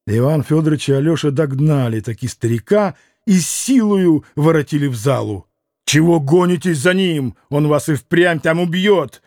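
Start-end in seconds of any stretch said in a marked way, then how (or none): none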